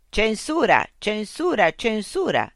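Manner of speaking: high, loud, repeated mechanical refusal; machine censor. Acted. Loudspeaker unit blocking Mitch's question. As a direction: stamp each word like a machine. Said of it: "rispose l'unità".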